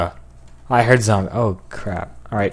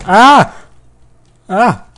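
high, loud short screams; startled screams; 11 semitones higher, 12 dB louder than usual. Real, not acted. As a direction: yell out in alarm. Screams while falling in game.

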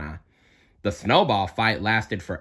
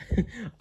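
high giggle; 12 semitones higher, 6 dB quieter than usual. Acted. Anna laughs after snowball.

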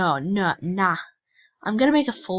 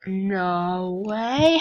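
ordinary talk, nothing marked

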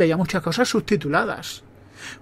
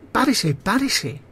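shoe-shop lady; 4 semitones higher, much slower than usual. Retold or imitated. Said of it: "la señora de la zapatería me dijo".

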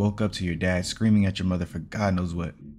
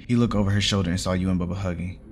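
ordinary delivery, nothing marked

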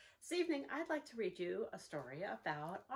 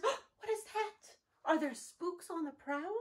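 scared mutter; 7 semitones higher, 5 dB louder, slower than usual. Acted. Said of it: "Bear mutters".

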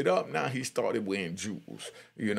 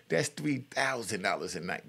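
usual speaking voice

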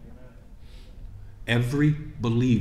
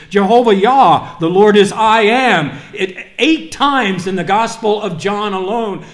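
preaching, building from steady to loud and high; building sermon; 9 semitones higher, 13 dB louder than usual. Acted. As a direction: rise to a shouted climax proclaiming the names of God. Preacher recites names of God.

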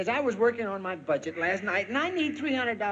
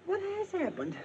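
anxious question; 10 semitones higher, 5 dB quieter than usual. Acted. First asking, distant and high.

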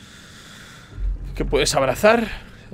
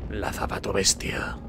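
ominous naming; 5 semitones lower, 7 dB quieter, slower than usual. Pretend.

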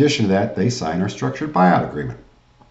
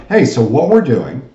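loud, high, confident sales opener; yes voice; 7 semitones higher, 9 dB louder than usual. Shown as a demonstration. Coach showing callers how to assume a yes, loud yes voice. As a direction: punch it upbeat, assuming they'll agree. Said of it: "you're using a yes voice".

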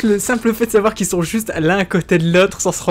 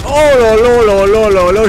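amazed exclamation; 3 semitones higher, 6 dB louder, slower than usual. Real, not acted. Gasping at the game.

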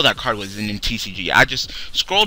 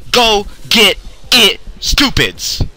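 slow, loud sneering company voice, partly higher; mocking Konami; 7 semitones higher, 3 dB louder, much slower than usual. Ranting fan mocks Konami as a smug, sneering company. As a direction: talk down like a smug company handing out junk. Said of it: "slap us in the face and be like".

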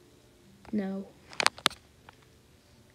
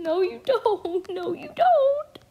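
slow, climbing high squeal, louder; rising squeal; 11 semitones higher, 8 dB louder, slower than usual. Real, not acted. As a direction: squeal it in panic. Game forces answers.

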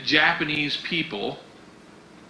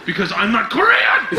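louder, higher, rising to a shout; indignant outburst; 5 semitones higher, 5 dB louder than usual. Acted. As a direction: burst out at a mistaken assumption. Polite answer bursts into indignation.

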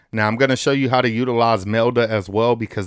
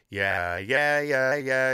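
hard-tuned robotic singing; robotic auto-tune voice; 5 dB quieter, much slower than usual. Shown as a demonstration. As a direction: snap every note like auto-tune. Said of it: "if you wanna sound more robotic".

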